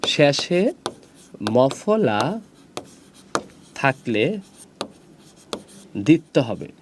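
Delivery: each word set apart by a long pause; word-by-word dictation. Shown as a demonstration. Teacher stating the same rule for final mafala, word-by-word dictation. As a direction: dictate slowly, leaving time after every word.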